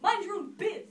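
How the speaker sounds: loud, high rebuff; brusque, full-voiced put-down; angry retort. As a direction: shout her away curtly. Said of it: "the prince replied".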